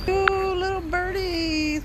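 high, slow sing-song coo; cooing delight. Real, not acted. Parent spotting two little birds, cooing delight. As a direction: coo slowly over tiny creatures.

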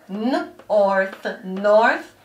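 "n", pause, "orth", then "north" joined; sounding out and blending. Shown as a demonstration. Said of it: "blend the syllables together".

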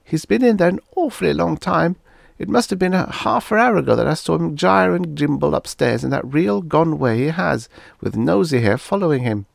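put-on a girl reporting with urgency; teenage girl. A narrator's reading. Daughter telling her parents the Martian is still inside, girl voice.

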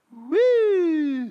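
high, loud siren whoop; imitated siren woo. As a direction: mimic a police siren wailing. Siren seen while speeding.

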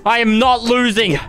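usual speaking voice

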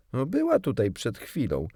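lightly voiced boy, a bit impatient; slightly impatient. A narrator's reading. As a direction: answer with mild impatience. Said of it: "odpowiedział troszkę niecierpliwie".

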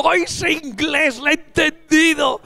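high, slow cry of relief; hammed-up joy at understanding; mock relief. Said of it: "Y tú".

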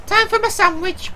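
high character voice. Acted. Voicing a game character.

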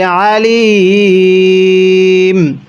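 Alim held very long on the ম; শেষ টেনে; drawn-out recitation ending. Shown as a demonstration. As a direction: stretch the final ম of Alim at length.

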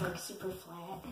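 ordinary delivery

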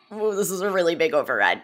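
comic overwhelm. Retold or imitated. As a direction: blurt it out loud, hamming up the alarm. Retold self-talk, played for laughs.

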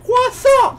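very high, loud laughing squawk; mock his shrieked catchphrase through laughter; mimicking the co-player. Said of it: "He was like".

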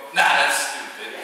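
loud, drawn-out dismissal; dismissive. Retold or imitated. Walking away from friend's alien story, dismissive. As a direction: wave it off loudly and slowly.

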